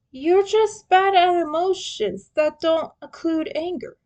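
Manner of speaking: high, loud teasing. A narrator's reading. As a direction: poke fun at him. Leo teasing.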